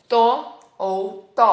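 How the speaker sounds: slow, loud, crisp Italian t sounds; demonstrated Italian t. Shown as a demonstration. Teacher demonstrating the Italian t sound, loud and slow. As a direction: say "to" slowly with a clean dental t.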